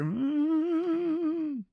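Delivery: high, drawn-out moaning hum. Retold or imitated. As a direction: hum it like an old lady savouring food. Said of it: "And I hear".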